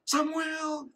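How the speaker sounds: high, drawn-out call; calling a name. Retold or imitated. Mother calling her lost child in a crowd, high call. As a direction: imitate calling across a crowded market. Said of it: "ada nama yang memanggil saya".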